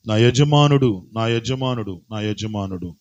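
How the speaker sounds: echoing refrain fading out; fading repetition. Acted. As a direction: let the phrase die away. Repeated phrase dying away.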